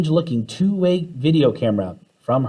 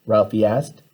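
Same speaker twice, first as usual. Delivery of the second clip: ordinary delivery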